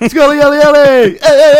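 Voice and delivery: high, loud excited dog calling. Acted. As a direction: call the dogs over, thrilled.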